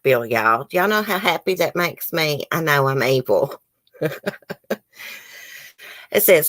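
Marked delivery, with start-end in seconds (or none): 4.0-4.7 soft, gloating little laugh; gleeful chuckle. Real, not acted. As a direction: chuckle wickedly under your breath. Gloating, admits being evil.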